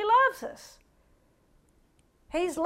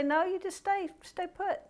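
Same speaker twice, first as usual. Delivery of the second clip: softer, higher calm instruction; gentle command; 4 semitones higher, 8 dB quieter than usual. Retold or imitated. The Lord telling a restless man to stay, softer. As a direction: hush him into staying put. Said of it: "the Lord was trying to say".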